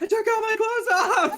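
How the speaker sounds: high, loud yell through laughter; laughing yell. Acted. Player joking about stripping naked, yelling through laughter. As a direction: shout it while cracking up.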